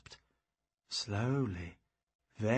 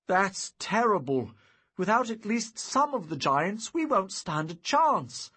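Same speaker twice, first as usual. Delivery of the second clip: alarmed; 7 semitones higher, 6 dB louder than usual. Acted. Alarmed at bad news.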